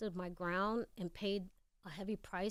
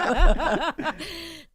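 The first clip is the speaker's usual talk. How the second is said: loud laugh; 7 semitones higher, 15 dB louder than usual. Real, not acted. Retelling the audition disaster.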